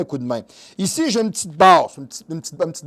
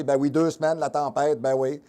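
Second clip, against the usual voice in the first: ordinary delivery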